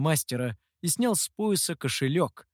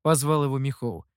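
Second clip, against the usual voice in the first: ordinary delivery, nothing marked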